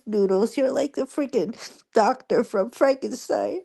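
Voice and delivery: high and wavering, half laughing through tears; fighting back tears while retelling the film; choked-up, tearful telling; teary voice. Real, not acted. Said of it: "I'm actually tearing up right now".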